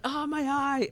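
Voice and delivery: high, pained mock wail; high-pitched fake cry of pain. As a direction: imitate a hurt kid crying out. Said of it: "he started crying".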